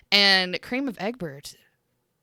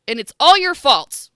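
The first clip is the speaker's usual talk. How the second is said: hammed-up accusation, louder; mock accusing; 5 semitones higher, 10 dB louder than usual. Mock blaming friend for song.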